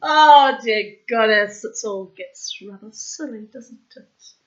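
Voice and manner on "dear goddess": usual speaking voice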